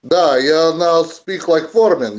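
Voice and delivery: lightly put-on Russian accent. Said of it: "speak in this, uh, Russian accent".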